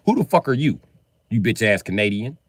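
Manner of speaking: ordinary talk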